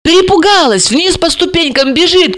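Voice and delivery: high, animated storytelling character; Alisa. Acted. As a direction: play Alisa recounting it eagerly.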